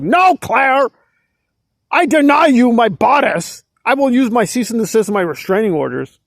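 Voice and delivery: hammed-up melodramatic refusal; high mock-heroine protest, easing toward the end; Nora. Nora fending Claire out of her bedroom, mock melodrama. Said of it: "Maybe Nora's fantasizing Claire Headley's trying".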